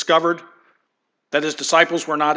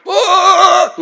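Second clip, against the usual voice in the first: a high, loud comic scream of the frightened storm; the storm yelps and flees, shrieking; comic storm cry, high and loud; storm's shriek; 11 dB louder than usual. Pretend.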